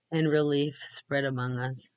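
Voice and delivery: usual speaking voice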